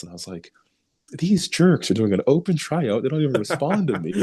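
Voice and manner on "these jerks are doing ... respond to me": retold grumbling about the team that ignored him; mock indignation. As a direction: complain like you've been snubbed, pitched up. Annoyed at the open tryout.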